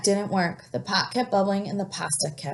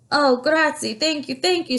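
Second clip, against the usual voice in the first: high, loud relieved thanks; grateful cries; 8 semitones higher, 4 dB louder than usual. A narrator's reading. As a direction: crowd overjoyed at being saved. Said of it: "the people cried".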